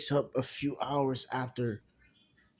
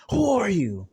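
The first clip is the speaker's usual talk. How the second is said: startled shout; 9 semitones higher, 6 dB louder than usual. Acted. Luffy startled by dark entity.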